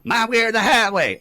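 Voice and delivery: high, booming mock ultimatum; loud mock demand. Mocking Washington politicians who won't negotiate, loud mock demand. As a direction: belt it out like a stubborn hardliner.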